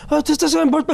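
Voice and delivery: high-pitched, stammering mock Korean accent. Shocked classmate tattling on two friends, high imitated Korean accent. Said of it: "He's just in his Korean accent".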